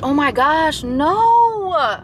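sudden high, loud gasp of no; real alarm at a crash outside; shocked cry. Real, not acted. Watching a crash from her car, shocked high cry.

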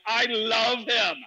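loud, high and drawn out; gushing enthusiasm. Retold or imitated. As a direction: declare adoration, stretching every word. Acting own gleeful reply.

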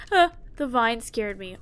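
ordinary talk

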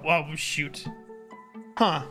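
ordinary delivery

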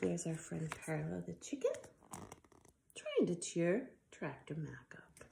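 soft hushed pointing at the page; whispered aside. Real, not acted. Aside about picture.

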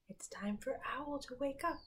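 soft whisper; whispering over a crib. Shown as a demonstration. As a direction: whisper so Owl keeps sleeping. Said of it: "Now we're very quiet".

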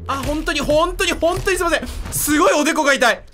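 高く大きな声でまくし立てる; 焦って上ずった声で謝り倒す. Real, not acted. 興奮して高い声で喋り続ける. (上ずった大声で謝る)

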